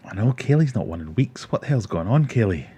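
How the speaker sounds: ordinary delivery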